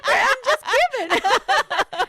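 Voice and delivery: laughing, high and loud, protesting she's not upset. Retold or imitated. Telling the host how she answered the client, laughing. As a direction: giggle through a mock protest. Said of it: "And I'm like".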